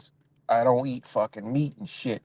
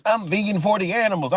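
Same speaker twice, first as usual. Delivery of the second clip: mock vegan; 7 semitones higher than usual. Imitating vegan ideology he rejects.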